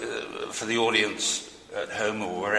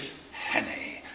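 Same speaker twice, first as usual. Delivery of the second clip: savoured whisper; 8 dB quieter than usual. Shown as a demonstration. Host relishing the actor's role name, whispered. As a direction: breathe "Richard Hannay" as if tasting the name. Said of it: "Trips off the tongue".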